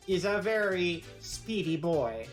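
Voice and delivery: ordinary talk, nothing marked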